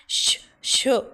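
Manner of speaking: sharp unvoiced shushing; urgent hush. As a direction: shush her in alarm. Alarmed mother hushes her daughter.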